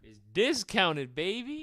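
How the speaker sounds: slow, teasing sales hype; playful hype. Real, not acted. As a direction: sell it like a cocky salesman.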